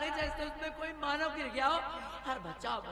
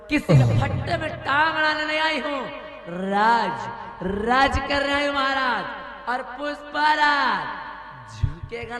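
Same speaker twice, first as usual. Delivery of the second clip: loud swaggering boast; 7 dB louder than usual. Acted. Queen boasting loudly.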